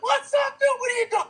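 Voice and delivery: very high, excited greeting. Retold or imitated. Rapper greeting his buddy, excited. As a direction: imitate a thrilled hello, pitch shooting up.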